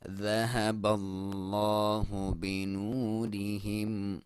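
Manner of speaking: slow melodic tilawat; slow Quranic recitation. Shown as a demonstration. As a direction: chant each word long and measured.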